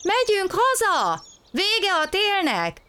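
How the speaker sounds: very high, drawn-out bird cries; birds calling. A narrator's reading. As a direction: sing out slowly like birds high overhead. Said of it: "mondták a madarak".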